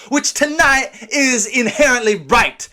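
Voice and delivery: ordinary talk, nothing marked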